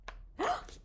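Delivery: quick high mock gasp; theatrical intake of breath; excited gasp. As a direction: gasp in pretend surprise. Suspense before the next animal.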